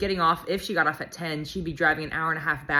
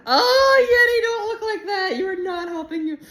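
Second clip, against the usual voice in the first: very high, loud wail easing in volume; mock-pleading wail; 13 semitones higher, 7 dB louder than usual. Real, not acted. Owner to dog not helping her case, wailing playfully. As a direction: coo a squealing playful protest at a pet.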